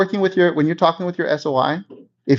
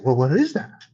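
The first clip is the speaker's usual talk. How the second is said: curious friend. Retold or imitated. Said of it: "That's what they're gonna say".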